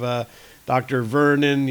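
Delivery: ordinary delivery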